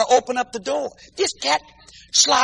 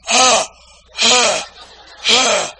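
dog panting; much slower than usual. Retold or imitated.

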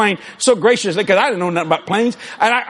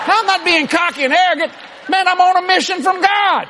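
higher, shouted declaration; preaching shout; 6 semitones higher than usual. Acted. Preacher defending his plane to the church, shouting. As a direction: proclaim it like a calling.

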